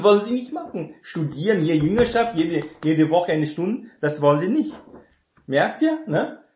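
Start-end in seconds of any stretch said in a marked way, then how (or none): none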